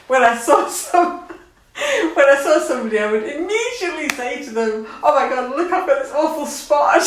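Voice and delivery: half-laughing quote of a silly worry. Real, not acted. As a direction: laugh at your younger self. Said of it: "I don't know what I'm laughing for".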